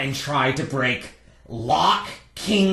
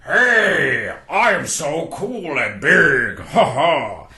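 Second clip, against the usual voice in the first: big guy's drawn-out boast; mock official; 4 dB louder, much slower than usual. Gatekeeper imitates the big guy for the visitors, mock official. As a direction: puff up slowly with self-importance. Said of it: "talk, like, all official and stuff".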